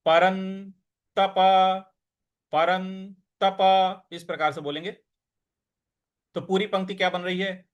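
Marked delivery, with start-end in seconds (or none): 0.1-4.1 परंतप very slow, half न pressed; stress on न. Shown as a demonstration. Teacher drills परंतप slowly with stress on न. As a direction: stretch परंतप, leaning on the न. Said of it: "इस पर थोड़ा सा बल लगाना है".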